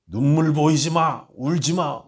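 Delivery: put-on loud, slow, stern command to a child; a retold stern mother scolding her son; stern mother's order. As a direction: bark the order like a strict mother who forbids tears. Strict mother forbids crying.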